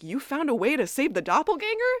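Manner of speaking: shocked disbelief. Retold or imitated. Elena shocked at the news.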